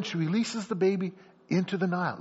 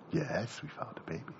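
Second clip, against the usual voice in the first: low, soft answer, trailing away; lightly acted, hushed and low; guarded princess reply; 5 semitones lower, 10 dB quieter than usual.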